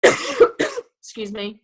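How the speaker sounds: ordinary talk, nothing marked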